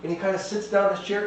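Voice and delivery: ordinary talk, nothing marked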